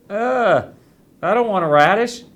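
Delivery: put-on high, loud whiny little boy; a kid groaning in disgust at a radish; whiny child. A narrator's reading. First grader refusing the nurse's radish, whining loudly.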